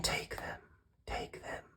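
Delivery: faint whispered echo; echo from the cave. Acted. Cave echo answering the duck, whispered and fading. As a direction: fade the word away like a cave echo. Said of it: "came back with a welcome sound".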